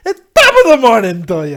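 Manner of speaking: booming mock Irish accent; very loud, over-the-top Irish greeting; Jacksepticeye. Host mocking an Irish YouTuber, booming Irish accent. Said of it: "sound like Jacksepticeye".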